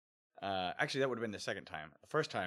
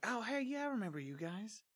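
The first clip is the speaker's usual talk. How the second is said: high-pitched imitation of a cheery greeting; mock friendly greeting. Ex-employee greeting kids he once caught, mock friendly. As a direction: imitate someone pleased to recognise you, pitched way up.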